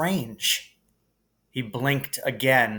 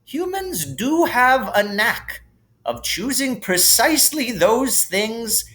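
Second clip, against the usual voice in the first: put-on kindly old headmaster; 7 semitones higher, 3 dB louder than usual. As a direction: share a gentle lesson about death like a wise old wizard. Narrator voices Dumbledore.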